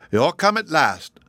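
sulky greeting from a peevish emperor. A narrator's reading. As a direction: grumble it like a put-out emperor. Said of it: "the Emperor sulkily remarked".

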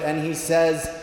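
ordinary talk

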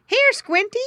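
high, loud call; high loud wife calling the pig. A narrator's reading. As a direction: call the little pig over. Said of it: "called the farmer's wife".

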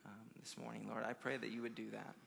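soft, low prayer voice. Real, not acted. Preacher closing a prayer with the congregation, quiet and reverent. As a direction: pray softly, pausing between phrases.

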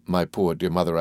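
usual speaking voice

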